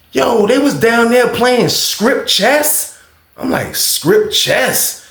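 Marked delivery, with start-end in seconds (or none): 0.1-3.0 high, drawn-out telling of juicy news; scandalized report. Retold or imitated. Roommate tells what he saw downstairs, imitated scandalized report. 3.8-5.1 high questioning "Script chess?"; incredulous echo. Retold or imitated. Storyteller hears roommate's gossip and echoes it, incredulous question.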